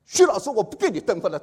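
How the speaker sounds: loud, indignant mock protest; angry student. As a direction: act out a student refusing in anger. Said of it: "他这个气的把这个笔啊".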